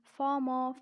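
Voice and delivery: British "farmer", loud, with the Rs dropped; British-accent demo of farmer. Shown as a demonstration. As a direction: say farmer the British way, open unrhotic ending. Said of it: "and the same way".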